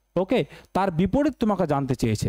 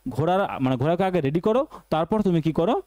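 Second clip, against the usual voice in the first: usual speaking voice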